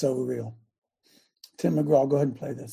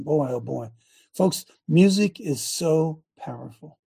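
ordinary delivery

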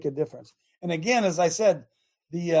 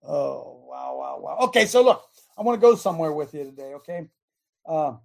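normal talking voice

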